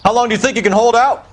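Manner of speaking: loud tough challenge; shouting. Acted. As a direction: yell it across the room. Cop shouting at holdout.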